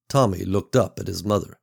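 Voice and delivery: ordinary delivery, nothing marked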